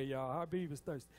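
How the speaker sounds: usual speaking voice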